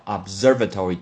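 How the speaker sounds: observatory with the accent on its second syllable; observatory as "ob-ZER-vuh-tory"; stress on SER. Shown as a demonstration. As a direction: lean on SER in observatory, light "va". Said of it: "重音在S E R".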